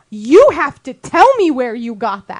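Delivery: insistent demand. Retold or imitated. Imitating the dump worker demanding an answer, slow insistence. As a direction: imitate a stubborn official, deliberate and emphatic. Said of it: "she just kept saying".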